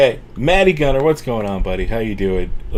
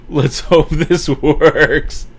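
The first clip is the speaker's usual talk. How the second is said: laughing through the words, very slow and louder; 6 semitones higher, 6 dB louder, much slower than usual. Real, not acted. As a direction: laugh your way slowly through it. Streamer laughs at the super chat.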